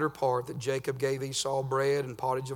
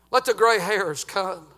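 loud, higher, near-sung preaching; rising holler of a revival preacher; fiery preaching; 9 semitones higher, 12 dB louder than usual. Acted. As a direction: preach it out full force, half chanting. Preaching at full force.

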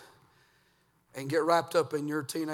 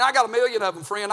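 usual speaking voice

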